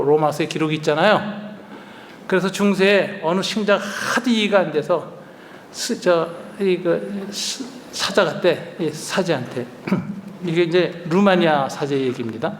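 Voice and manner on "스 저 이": ordinary delivery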